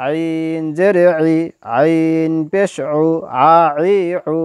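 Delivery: ordinary talk, nothing marked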